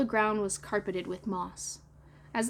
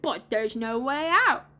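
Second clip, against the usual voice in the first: slow put-on boy voice, swooping up at the end; drawn-out lost-boy character voice; Slightly; 2 semitones higher, 4 dB louder than usual. A narrator's reading. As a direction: drag out the hopeless admission.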